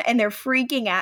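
ordinary talk, nothing marked

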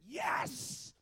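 loud, sudden "yes" of relief; retold excited cry. Husband hears wife's labour yell, retold excited cry. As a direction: burst out in mock triumph.

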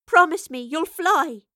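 loud, high, slow urgent call. A narrator's reading. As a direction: shout a last plea over the din. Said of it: "Evie called above the mounting wail".

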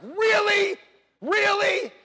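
high, shouted mock disbelief; loud challenge. Husband reacting to wife's complaint, loud challenge. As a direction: fire it back loud and high.

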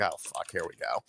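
low, soft retold aside; quiet resigned dread. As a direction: say it under your breath, imitating your past self. Dreading reaction to a punch.

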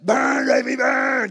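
high, loud roaring cheer; gleeful battle cry. Retold or imitated. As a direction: yell it triumphantly at the flames.

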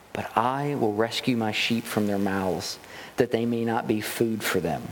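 low, measured Bible reading; solemn scripture read from the pulpit. Acted. Reads scripture passage aloud.

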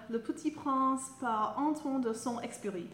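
"Le Petit Prince par Antoine de Saint-Exupéry" said the French way; French pronunciation. Shown as a demonstration. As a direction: pronounce "Saint-Exupéry" as a French speaker would. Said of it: "I wanna get [name] to say the author's name".

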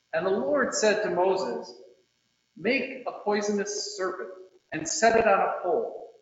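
usual speaking voice

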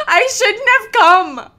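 high, loud mock wail of regret; panicked Shannon. Shannon wailing she shouldn't have come, high and loud. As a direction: cry it out like you're terrified. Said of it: "Shannon's like".

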